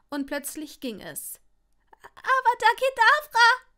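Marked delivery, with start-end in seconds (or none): 2.0-3.7 very high, loud mouse-like shriek; squeaked spell. A narrator's reading. Longbottom finally casting the killing curse, squeaking shrilly. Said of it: "Es klang zwar wie eine Maus".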